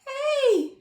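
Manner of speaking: high calling hey; a lightly voiced pig calling out; called hey. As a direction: call out to the animals, high. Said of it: "she says".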